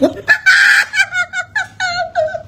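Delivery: long high cackle; real amused laughter. Real, not acted. Laughing at viewer reactions.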